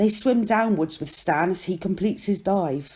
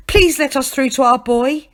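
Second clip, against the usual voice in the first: raised, loud pleading; urgent plea; 6 semitones higher, 7 dB louder than usual. A narrator's reading. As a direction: beg loudly to get past. Begging the crowd to let them through.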